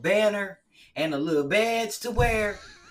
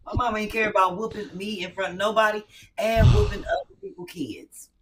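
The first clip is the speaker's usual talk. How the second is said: ordinary talk, nothing marked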